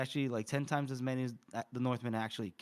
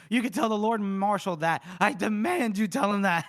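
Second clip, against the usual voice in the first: raised, louder commanding voice; higher, forceful mock outrage; indignant demand; 6 semitones higher, 5 dB louder than usual. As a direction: demand it like an affronted officer. Acting out an angry general.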